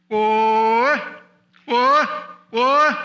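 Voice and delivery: high, loud cow-calling holler; imitated cattle call. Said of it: "here's how we called the cows".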